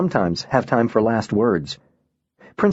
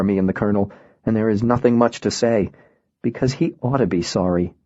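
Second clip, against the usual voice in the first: usual speaking voice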